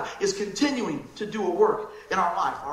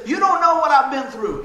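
louder, higher, pushing back hard; hurt, defiant retort; defiant outburst; 6 semitones higher, 9 dB louder than usual. Retold or imitated. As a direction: throw it back at them indignantly.

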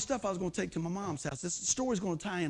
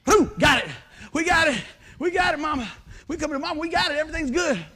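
high, loud celebration; excited triumph; 6 semitones higher, 6 dB louder than usual. Retold or imitated. Son telling Mama the generator works, loud and excited. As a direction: cheer the win to Mama.

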